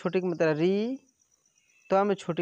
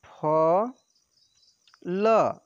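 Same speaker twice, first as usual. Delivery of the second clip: चा and र said apart with long pauses; syllable by syllable; 3 dB louder than usual. Shown as a demonstration. Teacher spelling out syllables for pupils, slow and separate. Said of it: "तो ऐसे व में छोटी की मात्रा से वि".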